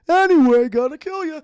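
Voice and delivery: high, loud mock battle cry; sudden comic switch to shouting; abrupt villain shout. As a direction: burst out yelling, cheerfully murderous. Sandman suddenly turns to attack.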